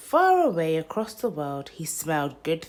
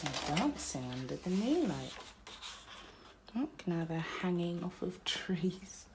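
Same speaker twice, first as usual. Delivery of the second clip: soft aside; 11 dB quieter than usual. Real, not acted. Aside to child about pictures.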